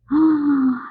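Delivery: sharp gasp of delight. Real, not acted. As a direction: gasp at something adorable. Sees a tiny paw on a card.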